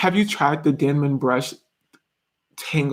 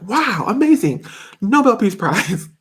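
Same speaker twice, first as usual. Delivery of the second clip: higher, drawn-out gushing praise; mock awe; 6 semitones higher than usual. People reacting to someone's accent, in mock amazement. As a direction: gush like an over-impressed crowd. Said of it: "people would be like".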